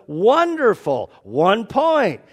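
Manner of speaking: loud, high, drawn-out congratulation; grand mock praise. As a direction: announce it like a delighted examiner. Peter acted praising the man again.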